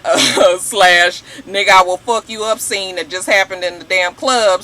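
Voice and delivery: normal talking voice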